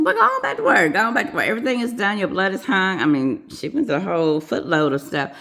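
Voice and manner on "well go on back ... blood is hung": high, raised imitation of a boss; acted dismissal. Manager sending the nurse back to work, dismissive. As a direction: mimic a manager shooing someone off, pitched up.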